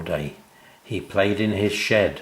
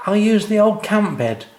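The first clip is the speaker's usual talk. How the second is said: put-on eager child; 12 semitones higher, 5 dB louder than usual. A narrator's reading. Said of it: "suggested Sam".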